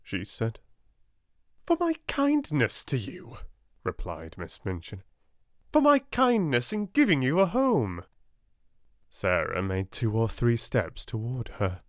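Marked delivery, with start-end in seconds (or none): 1.7-3.4 much higher, haughty claim; self-righteous headmistress. A narrator's reading. Miss Minchin claiming kindness. 5.7-8.0 much higher, haughty claim; self-righteous headmistress. A narrator's reading. Miss Minchin claiming kindness.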